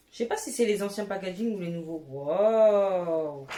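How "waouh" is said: delighted wow. Real, not acted. Opening palette.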